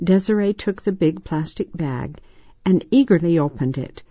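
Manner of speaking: ordinary delivery, nothing marked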